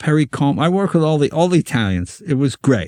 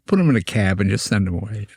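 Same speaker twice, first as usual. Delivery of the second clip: ordinary delivery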